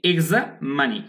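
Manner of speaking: এক্সামানি split as "egza, manee"; slow, pause midway through এক্সামানি; syllable-split pronunciation. Shown as a demonstration. Teacher answering own quiz for learners, syllable-split pronunciation. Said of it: "পরীক্ষার্থী নিয়ে কি?".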